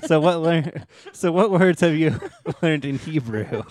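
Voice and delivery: loud, high, laughing question. Real, not acted. Host getting back to Hebrew after the taco tangent, laughing. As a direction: ask it through real laughter.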